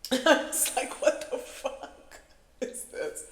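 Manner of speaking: high, slow words breaking up with laughter; laughing. Retold or imitated. Storyteller reliving their reaction to the flustered driver, laughing. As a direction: burst out loud, then squeeze the question through laughs. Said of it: "I was laughing".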